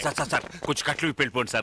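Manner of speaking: fast, quiet pleading; quick, hushed begging to be untied; hurried plea. Acted. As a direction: rush the plea under the breath.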